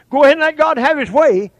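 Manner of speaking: high, loud imitated church shout; mimicking an exhorting holler; hollered encouragement. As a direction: call it out over the congregation. Acts out someone hollering encouragement.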